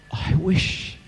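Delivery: high, hushed voice of a grieving widow; retold tearful regret. Grieving wife confiding in her pastor, hushed tearful regret. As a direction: softly imitate her grief, pitched well up. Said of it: "And she was crying".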